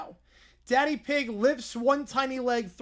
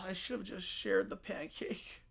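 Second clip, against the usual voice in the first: much softer, sunk regretful line; defeated mutter; 4 semitones lower, 11 dB quieter than usual. Acted. Bear regretting the pancake, slumped on the floor. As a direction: mumble it to yourself in defeat.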